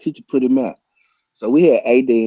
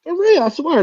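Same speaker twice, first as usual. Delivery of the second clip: ordinary talk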